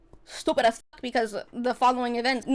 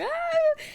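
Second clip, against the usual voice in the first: sudden high squeak of nerves; a tiny panicked yelp; high anxious squeak. Retold or imitated. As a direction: squeak like a nervous kid.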